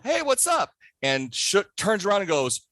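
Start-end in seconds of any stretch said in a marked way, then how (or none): 0.0-0.7 high, bright mock hello; cheerful greeting. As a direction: breeze in with a high hey. Wade's cheerful greeting retold.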